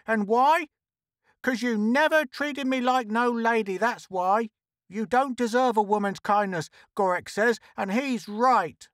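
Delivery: high-pitched aggrieved tirade; indignant high character rant; crying out. A narrator's reading. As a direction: rail on in a high, put-upon voice. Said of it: "Trixie cried on".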